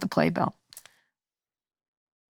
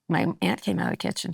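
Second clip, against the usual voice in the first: normal talking voice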